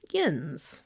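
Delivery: yins said the Pittsburgh way, with a nasal i. Shown as a demonstration. Vlogger demos evolved slang: Pittsburgh accent. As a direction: drop into the local accent for yins. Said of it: "That slowly evolved into".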